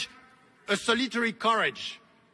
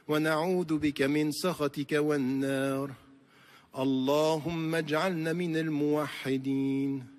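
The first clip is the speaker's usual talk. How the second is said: fast, lower Arabic supplication recited in a steady flow; closing prayer; 3 semitones lower, faster than usual. Real, not acted. Preacher leading the congregation in a closing Arabic prayer. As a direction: recite the closing dua with devotion.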